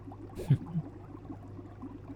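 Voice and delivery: one quiet, pleased huff; small knowing laugh; soft villain chuckle. Acted. Villain pleased as trance deepens.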